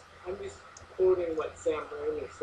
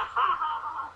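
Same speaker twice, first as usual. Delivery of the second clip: high-pitched mock laughter; put-on high ha-ha-ha; imitated moose laugh; 12 semitones higher, slower than usual. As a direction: laugh like the crazed moose in the film. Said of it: "like, laughing".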